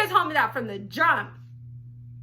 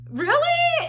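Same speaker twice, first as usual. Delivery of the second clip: jumps far higher in disbelief; incredulous; 14 semitones higher than usual. Retold or imitated. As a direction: squeak it like you can't believe your ears. Disbelief at killing six people.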